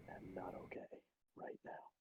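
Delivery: whispered confession of being shaken. Acted. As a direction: barely breathe the words out. Shaken character admits he's hurt.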